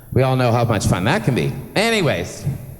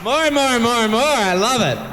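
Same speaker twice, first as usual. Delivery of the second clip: much higher, eager chant of more; thrilled calling out; 12 semitones higher than usual. Real, not acted. MC cheering as the singers keep coming, thrilled. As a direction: egg the crowd on as the singers pour out.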